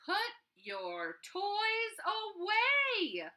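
high, loud, drawn-out scold; exasperated mom yelling enough. Acted. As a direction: stretch it like a mom at her limit.

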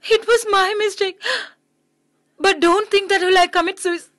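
choked up but defiant; crying. Acted. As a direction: fight through tears. Tearful confession, crying heard.

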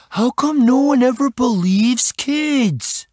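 put-on high, drawn-out kid whine; put-upon high-pitched kid; exasperated boy. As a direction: complain slowly as a fed-up boy. Boy rolls eyes, complains.

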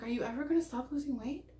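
mild imitation of worried people; worried intonation. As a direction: mimic their anxious worried tone. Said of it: "it's the intonation of how they're saying it".

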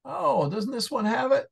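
sudden high startled question; surprised. Real, not acted. Tutor startled while checking the dictionary, surprised. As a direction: caught off guard by the page.

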